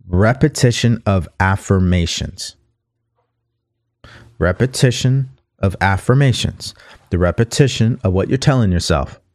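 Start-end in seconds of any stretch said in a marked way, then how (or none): 0.1-6.7 slow, deliberate read; slow it right down so listeners can pick it up. Shown as a demonstration. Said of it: "slow it down so you guys can pick it up".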